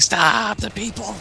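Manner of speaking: high, drawn-out frantic plea; mock-frantic Superman cry, stretched out. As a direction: plead in a high voice, hamming up the panic. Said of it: "he's, like, frantic".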